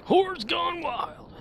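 high, slow, stretched-out announcement; dramatic falsetto. Pretend. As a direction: draw it out way up high. Streamer reads name dramatically.

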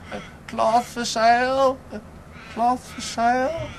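high, strained street cry of a tired peddler; calling till hoarse. Acted. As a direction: keep calling out as the voice wears out. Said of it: "until his voice became quite hoarse".

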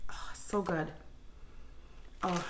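breathy whisper of pleasure; whispered delight. Real, not acted. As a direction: whisper it like a treat. Savoring the scent.

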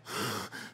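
imitated sharp gasp of fright; mock terrified gasp. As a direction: gasp like a kid caught out.

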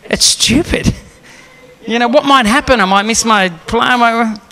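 mildly whiny retold protest; mock reluctant grumble, dragged out at the end; arguing with God. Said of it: "arguing with God".

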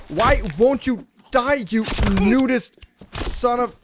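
high-pitched, fed-up outburst; exasperated yell. Real, not acted. Player fights a naked enemy in the game, exasperated high yell. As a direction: curse the enemy in high, drawn-out frustration.

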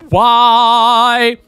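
loud drawn-out despairing why; exasperated cry. Real, not acted. Vlogger to viewers over the lip piercings, loud slow despair. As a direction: wail it slowly at the screen, fed up.

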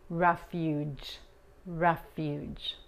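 "Refuge" ending in an English J sound, lips rounded; English J demo. Shown as a demonstration. Teacher showing the English J in "Refuge". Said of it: "la G se pronuncia como la J en inglés".

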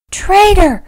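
high, loud cry of alarm; horrified gasp. A narrator's reading. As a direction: gasp it out in horror. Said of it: "she gasped".

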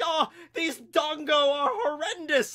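high, slow, whiny critic voice; snooty food critic. Pretend. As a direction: sneer it like a snobby gourmet. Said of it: "a very high-pitched, whiny food critic".

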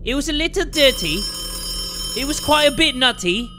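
hammed-up mock British lilt; British accent. Player joking about a dirty flashlight in a mock British accent. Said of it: "I don't even know why that accent came out".

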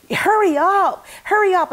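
panicked urging. Retold or imitated. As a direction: urge the staff on in a sudden panic. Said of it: "But I hear him panic".

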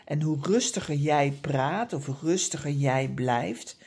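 ordinary delivery

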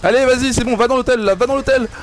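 high, loud urgent calls; excited urging. Real, not acted. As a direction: push your teammate on, frantic. Urgent calls during fight. (encouragements excités et pressants)

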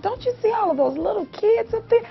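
high, sing-song persuading; re-enacted motherly cajoling in a high voice; coaxing mother. Mother coaxes reluctant child.

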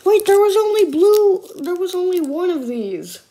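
surprised. Real, not acted. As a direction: catch it mid-thought, surprised. Noticing only one piece left.

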